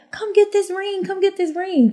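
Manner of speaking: high sing-song taunt; taunting rival. Retold or imitated. Rival girl taunting her from the car, high mocking call.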